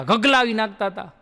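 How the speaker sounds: ordinary delivery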